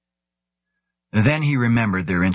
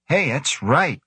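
brightening; 8 semitones higher, slower than usual. A narrator's reading.

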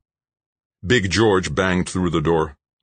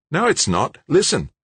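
higher and brighter, a big Aussie bloke talking up the night; young Aussie George; 12 semitones higher, 3 dB louder than usual. Acted. George's voice in the story.

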